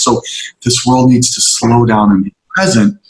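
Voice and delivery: usual speaking voice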